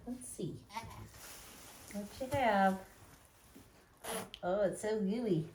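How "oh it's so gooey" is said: ordinary talk, nothing marked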